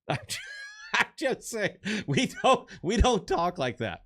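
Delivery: high squealing laugh through the words; laughing outburst. Real, not acted. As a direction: crack up and squeal it out. Laughing outburst after quote.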